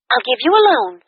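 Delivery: bright, high, fast upbeat friend voice; cheerful friend. A narrator's reading. Kathy invites her friend to dinner, cheerful and high.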